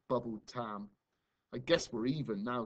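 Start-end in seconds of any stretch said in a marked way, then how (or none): none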